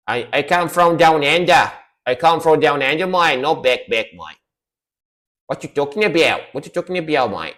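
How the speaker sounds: a hammed-up Australian accent; playing a bloke from down under; mock Australian accent. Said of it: "when I do the Australian accent".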